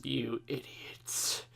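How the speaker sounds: very soft, slow put-down; muted mock disdain. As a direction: say it slowly under your breath. Acted disgust at overwhelmed friends.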